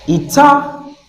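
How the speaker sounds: "Eternity" with the second syllable held high, then a break; stress on second syllable. Shown as a demonstration. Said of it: "So what's the stress?".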